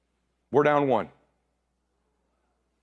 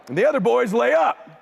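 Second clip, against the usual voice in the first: ordinary talk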